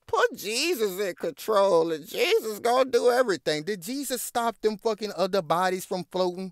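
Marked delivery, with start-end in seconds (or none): 0.1-3.6 high mocking preachy voice; mocking churchy quote. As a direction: parody her pious line, higher. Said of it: "all she was talking about is".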